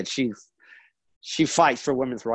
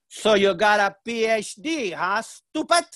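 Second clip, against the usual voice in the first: high imitation of mom's Spanish-accented English; mock teasing in a Spanish accent, pitched up; mother's accented English; 7 semitones higher, slower than usual. Mother mocks her graduate son, teasing in Spanish-accented English. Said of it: "she says in English, her best English".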